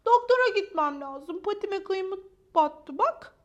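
high, loud whine, slowing down; plaintive complaint. Acted. Maya shows Ponçik her paw, high plaintive complaint. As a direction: plead your injury in a high voice.

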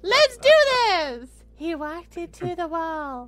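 high pretend little-kid read; child narrator. Starting horror stories as a child narrator, high pretend kid voice. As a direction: read it like an excited small child. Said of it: "sound like a child".

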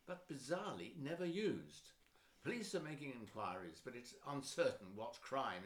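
measured news reading voice; news anchor. Acted. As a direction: read it like a TV bulletin. TV news bulletin read.

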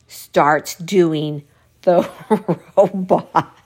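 slow, breaking into real laughter; can barely get it out for laughing; cracking up. Real, not acted. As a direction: laugh through the last word. Cracking up at her story.

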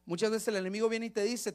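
ordinary talk, nothing marked

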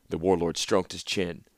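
ordinary delivery, nothing marked

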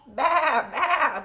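loud, high bleats; sheep bleating. Acted. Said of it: "bleated the sheep".

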